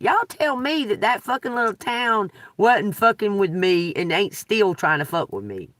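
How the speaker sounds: usual speaking voice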